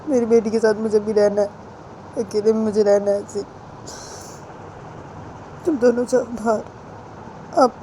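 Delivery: high, pleading voice sending them out; tearful mother. Acted. Crying mother asks husband and Bani to leave, high and pleading. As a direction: beg them to leave through tears. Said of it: "रोते रोते से बोलती है".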